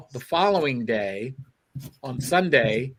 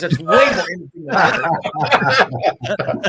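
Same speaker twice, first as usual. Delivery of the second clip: mock outrage; 6 semitones higher, 6 dB louder than usual. Mock outrage at art talk.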